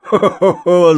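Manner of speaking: loud, high acted ho-ho-ho; big storybook guffaw; booming laugh. A narrator's reading. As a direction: laugh out heartily like the old cooper. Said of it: "загрохотал седой бочар".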